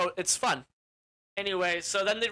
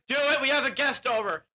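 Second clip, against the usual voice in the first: louder, higher bossy retort; mock angry order; 6 semitones higher, 5 dB louder than usual. Leader ordering his wife to cook, bossy. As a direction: order her about, voice raised.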